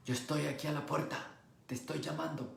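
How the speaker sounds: Jesus quietly asking to be let in; soft gentle call. Acted. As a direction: hush it, inviting. Jesus gently calling, softer retelling.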